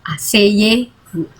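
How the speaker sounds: asseyez-vous drawn out slowly, final z silent; slow model reading. Shown as a demonstration. Teacher slowly modelling asseyez-vous for the class. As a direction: stretch out asseyez-vous for students to copy. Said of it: "jadi nggak dibaca".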